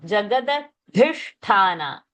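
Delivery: "jagadadhisthana" slow, plain d, then breathed dh and th; careful aspiration contrast. Shown as a demonstration. Teacher breaking down a hard word, "jagadadhisthana" slowly articulated. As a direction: draw out "jagadadhisthana", aspirating only dh and th. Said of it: "that the is alpa prana".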